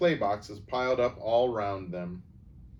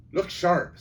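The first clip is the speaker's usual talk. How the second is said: louder, high urgent call; 8 semitones higher, 7 dB louder than usual. Acted. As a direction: snap them into action. Urgent command to siblings.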